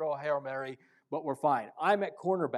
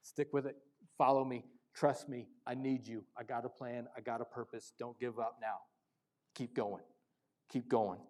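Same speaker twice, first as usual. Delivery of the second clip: lower, much softer, tender encouragement; God's gentle voice; 5 semitones lower, 8 dB quieter than usual. Retold or imitated. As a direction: speak as God coaxing a tired believer. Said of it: "And he's just saying".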